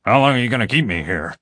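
impatient prisoner, gravel in the voice; gravelly, demanding. A narrator's reading. Prisoner demands answers from the officer, gruff and gravelly. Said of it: "the same deep, gravelly voice".

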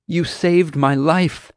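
slow, high, amazed exclamation; high-pitched grateful outburst; exclaiming. A narrator's reading. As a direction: exclaim with relief, drawing it out. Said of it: "she exclaimed".

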